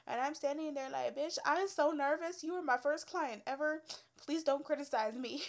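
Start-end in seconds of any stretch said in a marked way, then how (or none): 1.2-5.4 mock nervous plea. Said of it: "I was so nervous".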